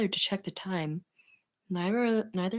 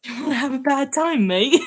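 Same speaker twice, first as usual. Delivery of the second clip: loud, high mock British accent; hammed-up British threat; Sans; 6 semitones higher, 8 dB louder than usual. Shown as a demonstration. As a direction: put on a badly faked British menace. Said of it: "Sans is actually British".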